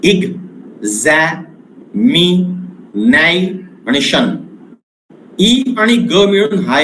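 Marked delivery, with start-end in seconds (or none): 0.1-4.3 "इग, झॅ, मी, नाय, शन" each syllable alone with pauses; syllable by syllable, spaced apart; split into syllables. Shown as a demonstration. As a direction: break the word into its five syllables. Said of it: "पाच तुकडे आहेत".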